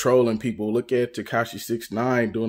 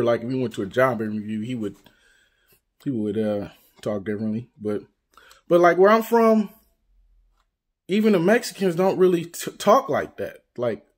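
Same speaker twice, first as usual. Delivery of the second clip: ordinary delivery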